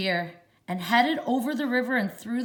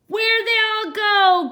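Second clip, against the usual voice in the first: much higher, loud witch shout; 12 semitones higher, 10 dB louder than usual. A narrator's reading. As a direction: yell it at the duck. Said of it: "Gritch shouted to a duck".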